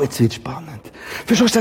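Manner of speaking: ordinary delivery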